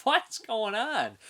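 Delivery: high, slow, mock baffled question; puzzled viewer wondering what is going on, high and drawn out. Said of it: "You're just like".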